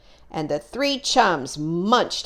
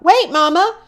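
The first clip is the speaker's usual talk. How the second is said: higher, louder worried call after mom; anxious call; 11 semitones higher, 6 dB louder than usual. Acted. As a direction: call her back, scared the monster is real. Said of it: "Maxwell Moose called out".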